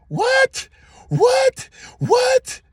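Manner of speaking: squeaky shouted disbelief; shocked exclaim. Real, not acted. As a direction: yelp it out in disbelief three times. Parked next to the kid.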